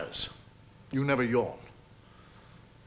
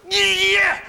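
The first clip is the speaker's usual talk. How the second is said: mock aggressive shout; 7 semitones higher, 6 dB louder than usual. Acting out aggressive gesture.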